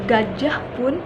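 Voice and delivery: plain reading voice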